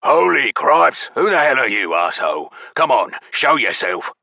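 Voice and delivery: loud, hoarse angry challenge; fierce hoarse goblin. A narrator's reading. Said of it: "That fierce voice, deep and hoarse".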